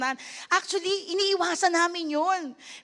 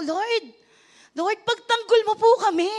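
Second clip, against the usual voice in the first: pleading to the Lord, higher and louder; a retold tearful cry for help, raised up; tearful prayer; 6 semitones higher, 4 dB louder than usual. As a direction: pastor pleading with God to defend them, tearful and raised. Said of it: "Umiiyak ako".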